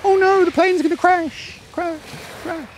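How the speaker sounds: high, drawn-out wail fading like an echo; tiny distant voice, echoing away on the last word. Shown as a demonstration. Coach demonstrating a fearful thought pushed into the distance, tiny echo. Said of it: "having it as a tiny echo of voice going".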